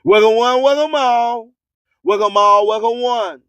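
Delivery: ordinary delivery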